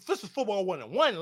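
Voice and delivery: normal talking voice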